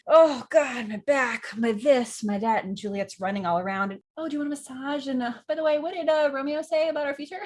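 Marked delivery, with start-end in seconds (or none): 0.1-2.6 loud groaning complaint, then easing off; complaining nurse. Acted. Nurse stalls Juliet with aches, groaning complaint. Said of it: "comes in and goes". 4.2-7.4 high-pitched, eager wheedling; sweet-talking. Acted. Juliet fishes for Romeo's answer, high-pitched sweet-talking. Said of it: "she is all sweet talking".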